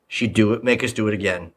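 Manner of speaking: ordinary delivery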